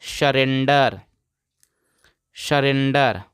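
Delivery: "सरेंडर" repeated evenly as a model word; pronunciation demo. Shown as a demonstration. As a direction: model "सरेंडर" for learners, pausing between repeats. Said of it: "इसको पढ़ते हैं".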